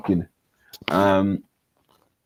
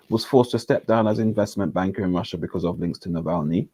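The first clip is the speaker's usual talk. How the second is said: ordinary talk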